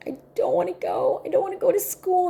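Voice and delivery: mock child's wail, jumping high; imitated crying. Niece bursting out crying before school, imitated wail. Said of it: "she just burst out crying".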